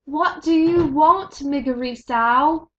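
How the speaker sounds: high, loud shouted question; loud demanding shout. A narrator's reading. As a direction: call it out to her. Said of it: "the princess shouted".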